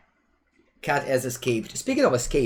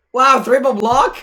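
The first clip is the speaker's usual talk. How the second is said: loud, high burst of surprise; surprised outburst; 13 semitones higher, 12 dB louder than usual. Real, not acted. Streamer reacts to a triple block, surprised. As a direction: blurt it out in astonishment.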